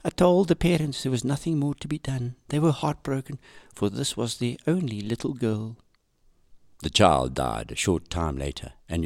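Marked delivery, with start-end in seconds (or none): 0.0-5.8 higher, softer diary voice; reading a diary. Acted. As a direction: read her sad diary entry quietly. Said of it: "she writes in her diary".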